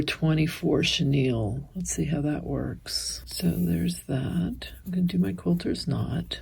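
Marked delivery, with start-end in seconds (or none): 1.7-6.4 soft unvoiced whisper while threading the needle; whispered asides while concentrating on the needle; whispering to herself. Real, not acted. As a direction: murmur it under your breath, busy with your hands.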